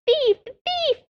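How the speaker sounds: shrill, very high screeching cry; screaming jay. Acted. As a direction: screech it like an outraged bird. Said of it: "once more began to scream".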